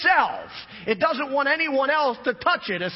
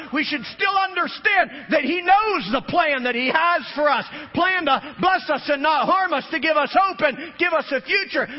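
rising, louder sermon cadence, near shouting; preaching at full force; 5 semitones higher, 3 dB louder than usual. Acted. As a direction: preach it out to the whole church.

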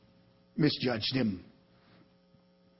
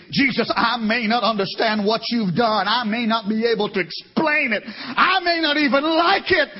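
building, loud declaration of faith; preacher voicing Martha's faith; impassioned preaching; 7 semitones higher, 4 dB louder than usual. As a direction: swell toward the final words.

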